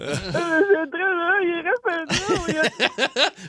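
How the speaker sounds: high, slow blubbering, imitating himself in tears; hammed-up tearful wail, words barely getting out; mock bawling. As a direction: fake sobbing while trying to answer his kids. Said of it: "brailler comme un veau dans le cinéma".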